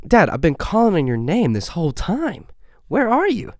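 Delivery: a daughter fed up with calling; frustrated daughter. Retold or imitated. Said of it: "the daughter walks out of the room saying".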